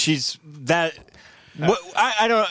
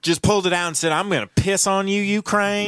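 higher, hammed-up mock storytelling; Kamala Harris; 5 semitones higher than usual. Host mimicking the vice president on the invasion, terrible impression. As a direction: badly impersonate a simplistic explainer, pitched up. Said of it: "being terrible at impressions".

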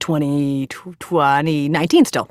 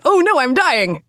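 higher-pitched, drawn-out melodramatic alarm; mock panic; 7 semitones higher than usual. Patient retelling her double-vision scare, in mock panic. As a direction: overplay your own panic.

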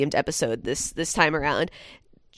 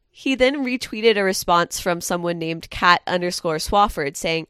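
usual speaking voice

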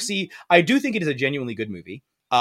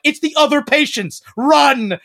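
high, loud urgent order to run; urgent girl; 11 semitones higher, 6 dB louder than usual. Retold or imitated. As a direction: push her to flee now. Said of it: "the little blonde girl's like".

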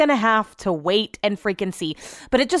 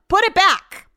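higher, emphatic mock scolding; mock demand; 5 semitones higher, slower than usual. Shopper demanding Nordstrom return to the mall, mock demand. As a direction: order it back, stretching the words.

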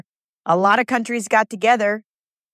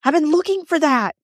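put-on much higher, eager old woman's voice; excited older woman; 10 semitones higher than usual. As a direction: gush like a delighted older lady. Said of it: "this woman said".

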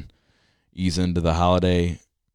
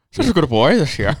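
mock Irish Santa, higher and louder through a laugh; laughing imitation of an Irish Santa; Irish-tinged Santa; 10 semitones higher, 6 dB louder than usual. Co-host mimicking the Santa line with an Irish twang. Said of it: "almost an Irish twang to it".

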